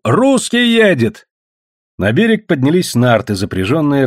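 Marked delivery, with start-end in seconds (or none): 0.0-1.3 high, drawn-out alert cry; calling out. A narrator's reading. Someone warning the crowd of an arrival, crying out. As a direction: cry out the news to everyone. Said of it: "Тут кто-то крикнул".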